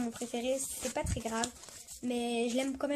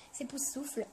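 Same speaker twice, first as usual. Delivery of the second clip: confiding whisper. Real, not acted. Confiding her favourite house.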